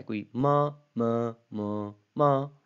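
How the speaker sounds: मा said with four different pitches; ma in different tones. Shown as a demonstration. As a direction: say मा, म, म, मा each on a new tone. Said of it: "different pitches के अंदर मैंने सुनाने की कोशिश की". (मा को चार अलग सुरों में बोलना)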